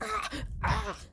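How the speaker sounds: high strained mock choking; strangled little gasps. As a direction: gasp like something is around the neck. Choking doctor, limb round neck.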